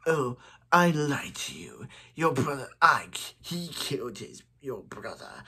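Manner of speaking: lightly voiced villain, dropping away; a hint of hushed villain; Zoom voice. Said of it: "I do a very good Zoom voice".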